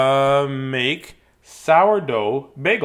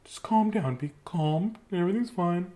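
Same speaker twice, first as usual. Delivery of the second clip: soothing imitation; 3 semitones higher, 7 dB quieter than usual. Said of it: "And she's like".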